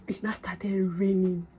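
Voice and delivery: whisper. Real, not acted. Whispered dramatic reveal.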